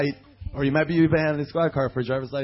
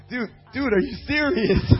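high, loud mock disbelief; incredulous protest; 7 semitones higher, 7 dB louder than usual. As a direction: plead with the officer, exaggerated disbelief. Retells protest to the arresting officer.

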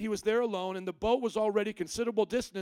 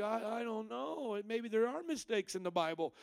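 naive, unsure believer; 4 dB quieter than usual. Retold or imitated. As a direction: stammer it out like an unprepared believer caught off guard. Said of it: "if you're naive".

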